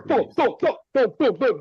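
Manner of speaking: loud, rising booms of boards slamming; imitated booming. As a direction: thump out each boom like debris hitting walls. Boards hitting the house.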